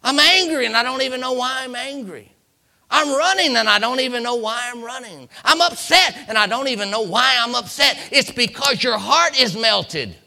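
high, loud preacher's cry; full-force preaching. Acted. Preacher acting out a melted heart's confusion, full-force preaching. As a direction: hammer each confession out to the congregation.

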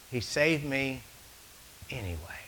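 soft, with anyway whispered; fading to a whisper. Shown as a demonstration. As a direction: let it drop to a hushed secret. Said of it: "you already caught that?".

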